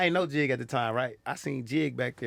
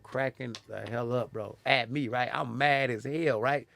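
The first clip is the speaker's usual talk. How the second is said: ordinary talk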